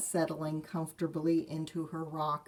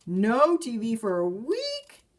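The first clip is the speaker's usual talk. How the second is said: high, loud shocked cry; shocked disbelief, loud and high; 5 semitones higher, 8 dB louder than usual. Acted. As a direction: cubs hear the bad news, shocked. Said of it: "said the shocked cubs".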